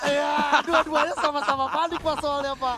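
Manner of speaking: laughing through the words. Real, not acted. Caster tertawa saat bercanda. (tertawa sambil bicara)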